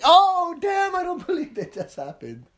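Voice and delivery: high, loud shocked gasp; shocked disbelief. Retold or imitated. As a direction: act stunned by a twist. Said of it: "other parts you're like".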